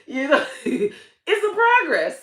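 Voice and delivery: ordinary talk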